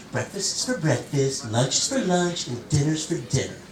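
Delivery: hint of a mocking imitation of a husband; wife mimicking husband. Wife quoting husband's food rules back, mocking imitation. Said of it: "I never use that fucking voice".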